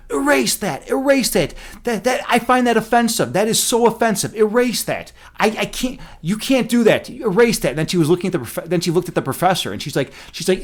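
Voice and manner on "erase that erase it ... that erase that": high-pitched indignant demand, retold; mock outraged classmate insisting it be erased; freaking out. Classmate demanding the professor erase the word, high mock outrage. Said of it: "She completely freaked out and said".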